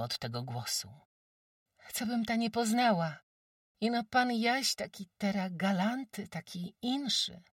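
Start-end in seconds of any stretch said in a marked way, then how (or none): 1.9-7.4 put-on high, bright village girl; high girl's voice. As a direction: play a shy, admiring peasant girl. Narrator voices Jagusia, high.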